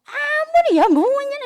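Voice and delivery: high, loud snapping accusation; angry outburst. Retold or imitated. Friend angrily blaming the other, loud and high. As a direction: lash out at the friend. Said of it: "tapi nggak yang gini".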